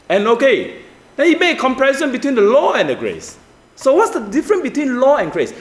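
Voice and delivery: high, urgent pulpit voice; impassioned preaching. Real, not acted. Preacher setting Moses against Jesus for the congregation, impassioned preaching. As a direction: drive the contrast home at a high pitch.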